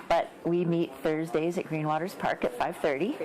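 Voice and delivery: ordinary talk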